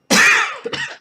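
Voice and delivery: sudden loud cough; real cough. Real, not acted. Host still unwell after reading a poem, coughing. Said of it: "I'm gonna be coughing".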